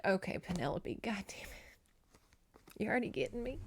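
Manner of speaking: quietly overwhelmed by the book; teary hushed aside. Retold or imitated. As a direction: murmur it like you're fighting tears. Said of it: "I was like".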